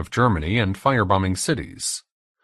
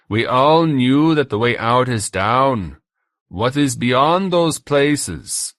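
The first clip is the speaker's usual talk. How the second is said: loud, higher German tormentor; German accent; 5 semitones higher, 5 dB louder, slower than usual. A narrator's reading. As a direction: dismiss it loudly with a German accent. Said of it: "The accent was German".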